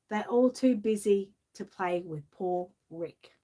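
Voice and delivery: lilting storybook read-aloud; gentle sing-song picture-book reading; reading to children. Acted. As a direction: read the rhyme like bedtime for kids.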